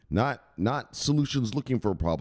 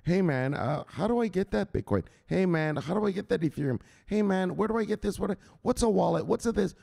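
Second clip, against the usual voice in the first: higher, sheepish newbie voice; clueless eager asker; 10 semitones higher than usual. Retold or imitated. Imitating former mockers now asking about Bitcoin, higher sheepish voice. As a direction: play the late friend begging for tips. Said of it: "now they come around".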